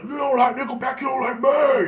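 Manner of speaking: high mock shout; imitated scream. Imitating the drunk friend yelling in his face, high shout. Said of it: "he was just screaming in my face".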